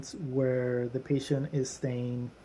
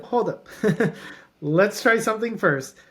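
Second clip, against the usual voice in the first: higher, louder retort with a laugh; amused, raised-voice interjection; retold objection; 6 semitones higher, 5 dB louder than usual. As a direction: break in brightly with a chuckle, halting the plan. Clinic voicing its objection to doctors.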